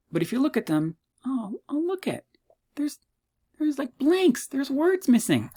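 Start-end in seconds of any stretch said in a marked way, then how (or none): 1.2-5.5 high-pitched, put-on voice of surprise; mock surprised student. Lecturer mimicking students who find blanks, high mock surprise. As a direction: play a student stumbling on something odd, voice high.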